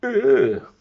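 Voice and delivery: loud, high mock-disgusted groan; disgusted ugh. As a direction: make the children squirm. Disgust at slimy frog in bed.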